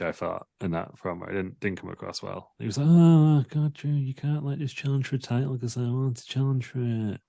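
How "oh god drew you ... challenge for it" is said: high, petulant whinge; whiny. Retold or imitated. Sheamus whines at Drew for taking his title shot, in a high petulant voice. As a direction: whine like a sulky kid denied a turn. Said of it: "he looked like a little bitch".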